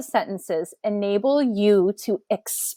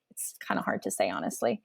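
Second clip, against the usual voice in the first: muttered aside; 15 dB quieter, faster than usual. Real, not acted. Muttered aside mid-lesson.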